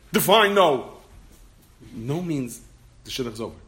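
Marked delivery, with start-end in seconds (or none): 0.1-1.0 loud, high challenge; acted-out protest, voice jumping up; retold indignant demand. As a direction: throw it back loudly in mock disbelief. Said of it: "And of course he said".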